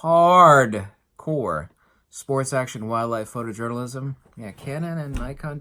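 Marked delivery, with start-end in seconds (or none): 0.0-1.7 hardcore said alone, loud, punched and falling; stressing hardcore. Shown as a demonstration. Vlogger hammering the word hardcore for viewers, loud and emphatic. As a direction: slam the word hardcore on its own. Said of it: "let me emphasize the word hardcore".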